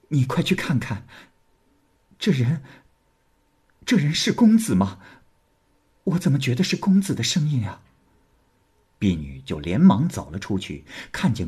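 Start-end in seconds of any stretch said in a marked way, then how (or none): 0.1-7.8 put-on a higher, urgent young lady's voice; anxious young woman. A narrator's reading. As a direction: hurry the maid, half hoping. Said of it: "就对婢女说". (焦急的年轻女子)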